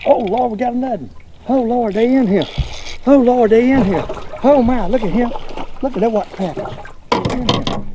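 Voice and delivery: can't believe they're biting; thrilled. Real, not acted. Fisherman finding crappies in the brush, overjoyed.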